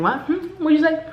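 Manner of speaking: high, straining what'd you say; puzzled what. Retold or imitated. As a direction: call out as if you can't hear him. Straining to hear a mumbling guest.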